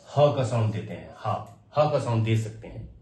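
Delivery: ordinary talk, nothing marked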